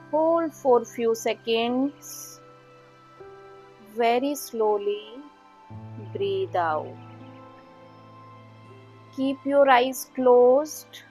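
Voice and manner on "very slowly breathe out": ordinary delivery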